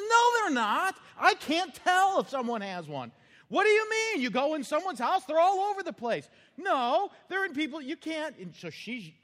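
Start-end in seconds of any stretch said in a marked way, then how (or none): 0.1-3.1 very high, loud mock bickering wife; hammed-up wife quarrel; mock argument. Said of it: "they have an argument". 3.5-6.3 very high, loud mock bickering husband; hammed-up old man quarrel; mock argument. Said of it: "they have an argument". 6.6-8.3 very high, loud mock bickering wife; hammed-up wife quarrel; mock argument. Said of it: "they have an argument".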